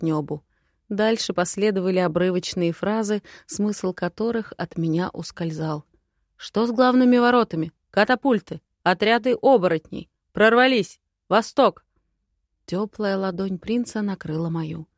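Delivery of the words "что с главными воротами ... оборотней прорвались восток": urgent. Acted. Urgent war report fragments.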